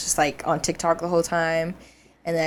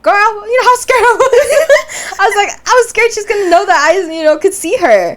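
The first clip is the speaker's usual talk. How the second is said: excited; 10 dB louder than usual. Retold or imitated. Relieved rant to her sister.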